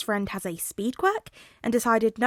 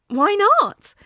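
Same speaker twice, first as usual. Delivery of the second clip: high loud pouting protest; pouty whine; 12 semitones higher, 11 dB louder than usual. Acted. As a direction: whine it like a sulking kid. Tensei pouting at Hazashi.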